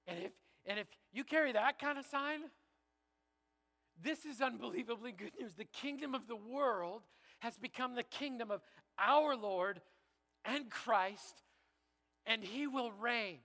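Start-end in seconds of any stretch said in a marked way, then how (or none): 5.5-13.4 louder, higher, proclaiming the verse; bold proclamation. Shown as a demonstration. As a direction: announce it like a victory, slowing on he will reign. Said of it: "Let me read it again".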